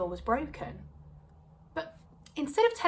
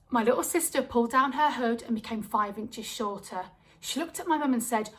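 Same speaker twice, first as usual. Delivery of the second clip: usual speaking voice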